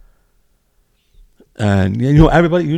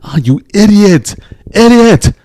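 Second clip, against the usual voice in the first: high, loud mock yell of idiot; a fan screaming at his benched player; retold yelling; 10 semitones higher, 4 dB louder than usual. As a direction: yell at the screen in frustration. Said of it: "You're screaming, yelling".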